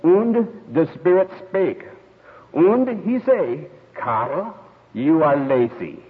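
put-on old German professor's accent, drawn out; heavy German accent. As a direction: intone it solemnly in a thick German accent. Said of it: "then with his German accent, he says".

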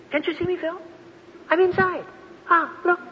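high, light acted pleading; gentle exasperation. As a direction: coax a friend who cannot see. Voices Jesus answering Philip.

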